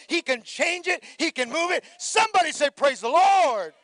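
shouted, high preaching; full-force preaching. Acted. Pastor exhorting the congregation, full-force preaching. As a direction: drive it up to a ringing call.

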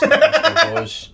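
high, slow laughing echo. Real, not acted. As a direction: giggle the name out high.

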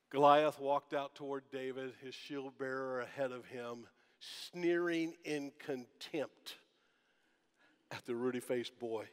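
usual speaking voice

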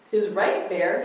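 plain reading voice

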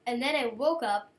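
normal talking voice

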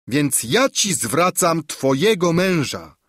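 ringing cry, weight on ja; triumphant exclamation. A narrator's reading. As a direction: hand him back to her with a flourish. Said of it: "wykrzyknęła czarna wróżka". (triumfalny okrzyk wróżki)

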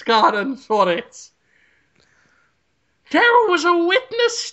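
put-on hammy high, slow Irish accent; Irish police chief. Police chief greeting the sergeant in a hammy Irish accent. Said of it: "I'll be there as, as, uh, Chief O'Leary".

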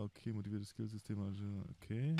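ordinary talk, nothing marked

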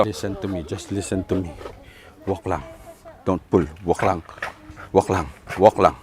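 low, hushed, steady cues to the dog; quiet calm commands. Real, not acted. Trainer murmuring to the dog on the leash, hushed and calm. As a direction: settle the dog with a soft voice.

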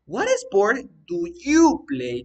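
loud, slow, "you" high and drawn out; teacher punching "you" in a slow question; stress on YOU. Shown as a demonstration. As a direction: hit "you" hard to show the subject. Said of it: "¿qué viene a ser? You".

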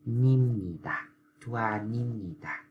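nim nhì tà, n carried over, slowly; linking demo. Shown as a demonstration. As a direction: thu a nim nhì tà, slide the N into the next syllable. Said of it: "rồi đá chữ N qua đây".

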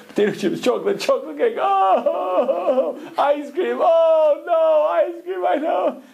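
very high, shouting mock hysteria; hysterical dieters. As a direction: shriek the foods like you're losing it. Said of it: "They were losing it".

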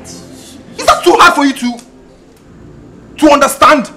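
loud, drawn-out, broken-up question; high, furious, spelled-out challenge; slow angry shout. Acted. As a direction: yell it slowly, pausing before "understand". Acted quarrel, question repeated emphatically.

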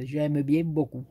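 playful southern French twang on "j'aime bien beaucoup"; Marseille accent. Shown as a demonstration. Vlogger repeats her praise of Dan in a mock Marseille accent. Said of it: "c'est à la Marseillaise que vous voulez".

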